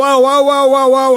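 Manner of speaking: high, loud mock cries of "ow"; mock pain cries. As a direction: yelp like a sinner in hell, hammed up. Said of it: "and you have to go".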